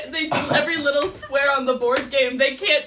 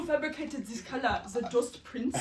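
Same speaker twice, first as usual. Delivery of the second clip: mock German accent, breaking into laughter; German mother; 7 dB quieter than usual. Quoting the German mother in the closet, German accent. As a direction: play a disdainful German mother. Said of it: "The one where she's going through the closet".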